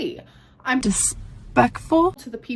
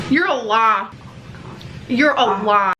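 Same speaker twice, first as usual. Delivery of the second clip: loud playful accusation; 6 dB louder than usual. Real, not acted.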